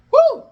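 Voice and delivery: high, loud celebratory woo; loud whoop. Real, not acted. Fan still hyped after the title change, whooping loudly. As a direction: let out a big wrestling-fan woo. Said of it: "Let me catch my breath first".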